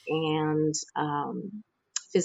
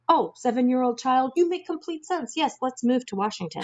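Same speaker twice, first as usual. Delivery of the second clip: much higher, lightly hammed-up realization; mock epiphany; 7 semitones higher than usual. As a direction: play your own sudden lightbulb moment, half-joking. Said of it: "so it's just like me".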